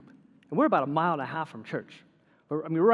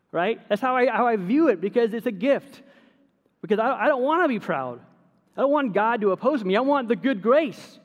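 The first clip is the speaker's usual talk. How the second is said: raised, louder preaching push; fervent preaching; 7 semitones higher, 4 dB louder than usual. Acted. Pastor urging his church to thank God, fervent preaching. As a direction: preach it up to the congregation. Building preaching about humility.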